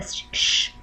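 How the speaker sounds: "sh" as pure unvoiced friction; "sh", T and I blended into one hushing sound; isolated sh sound. Shown as a demonstration. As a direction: make a bare "sh" with no vowel. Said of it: "sh sound".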